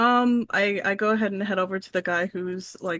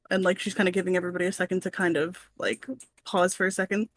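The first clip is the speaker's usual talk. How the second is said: ordinary delivery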